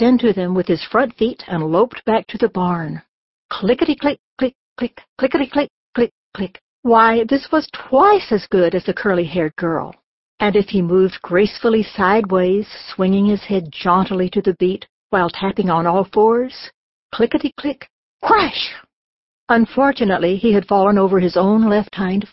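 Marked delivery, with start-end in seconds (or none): 3.5-6.6 playful rhythmic tapping words; tapping sound effect. A narrator's reading. Narrator mimicking pig tapping to barn, rhythmic. 17.1-18.7 rhythmic tap, then a sudden high crash; tapping then crash. A narrator's reading. Narrator voicing pig tapping then tumbling, sudden crash.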